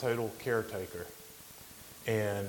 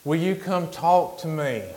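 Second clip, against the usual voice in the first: put-on older man calling slowly to someone across the hall; raised, stretched-out plea for company; hollering out; 6 semitones higher, 6 dB louder, slower than usual. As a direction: call out loud and high, drawn out. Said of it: "he hollered out to me".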